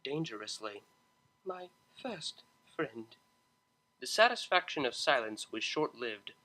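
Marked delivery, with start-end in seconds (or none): 1.4-3.1 soft, slow and firm; quietly defending her friend; quiet firmness. Acted. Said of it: "replied quietly".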